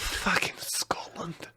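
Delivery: put-on soft, slow whispered Scottish accent. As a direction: breathe out "Fucking Scotland" in a Scottish accent. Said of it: "Scottish Viking".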